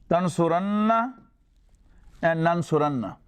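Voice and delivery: Yansuranna with the noon held double, nn lingering before the final a; doubled noon. Shown as a demonstration. Teacher shows students the heavy emphatic form, doubled noon held. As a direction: lean on the doubled nn in tansuranna, tansurinna and nansuranna. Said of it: "will turn to noon mushaddad and fatha".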